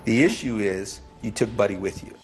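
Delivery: ordinary talk, nothing marked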